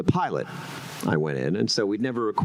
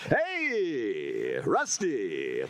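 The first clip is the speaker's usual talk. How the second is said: Mister Fabulous; 2 semitones lower, much slower than usual. Shown as a demonstration. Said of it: "Mister Fabulous".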